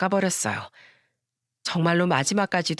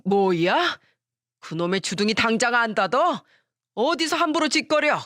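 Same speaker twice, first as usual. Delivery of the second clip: an outraged father shouting down his son; furious shout; 3 semitones higher than usual. Acted. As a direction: yell at the son to shut his mouth. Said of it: "소리치셨어요".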